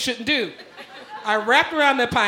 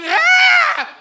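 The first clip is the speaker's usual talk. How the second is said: high loud "ah" scream; panicked scream; 7 dB louder than usual. Retold or imitated. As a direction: let out a terrified scream. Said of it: "And I'm".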